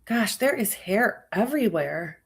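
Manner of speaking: ordinary delivery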